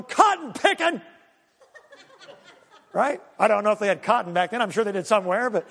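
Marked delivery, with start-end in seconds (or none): 0.0-1.0 angry Jonah. Retold or imitated. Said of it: "he's up on the mountain going".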